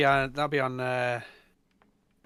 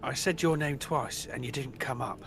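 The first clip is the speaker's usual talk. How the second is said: normal talking voice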